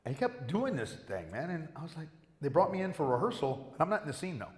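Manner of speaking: normal talking voice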